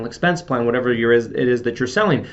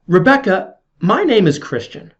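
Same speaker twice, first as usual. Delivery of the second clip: higher, louder sales-call introduction; high energy; 6 semitones higher, 4 dB louder than usual. Shown as a demonstration. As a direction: introduce yourself with an energetic lift. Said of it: "start the call with high energy".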